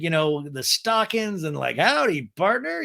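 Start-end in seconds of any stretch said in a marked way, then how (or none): 1.8-2.8 put-on old time saloon girl. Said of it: "old time rootin' tootin'".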